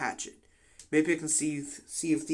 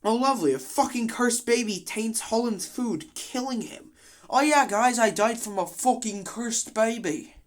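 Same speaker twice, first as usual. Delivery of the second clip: a playful borrowed British lilt, pitched up; put-on British accent; 7 semitones higher than usual. Commentator slipping into a dead character's mock British accent. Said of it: "I don't know why I'm speaking like this".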